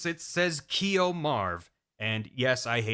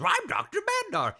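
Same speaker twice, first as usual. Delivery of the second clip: much higher, cartoonish scientist voice; high silly old doctor. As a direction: squeak it out like a goofy old professor. Madnar introduces himself.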